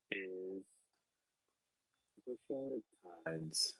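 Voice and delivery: muffled, bad connection; muffled mic. Real, not acted. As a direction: sound muffled through the mic. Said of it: "your microphone is sounding, like, very muffled".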